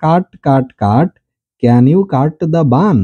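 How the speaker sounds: slow reading demo. Shown as a demonstration. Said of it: "বলা হচ্ছে".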